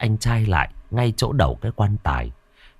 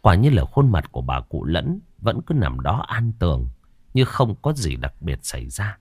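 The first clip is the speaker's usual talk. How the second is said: plain reading voice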